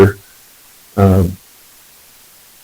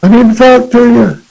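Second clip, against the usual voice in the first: yelling across church; slower than usual. Retold or imitated. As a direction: shout their names over the crowd. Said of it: "he yelled out".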